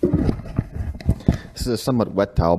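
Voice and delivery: muffled under a wet towel; towel over head. Real, not acted. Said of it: "I have a towel over my head now".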